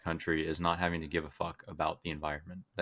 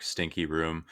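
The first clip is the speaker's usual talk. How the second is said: ordinary talk, nothing marked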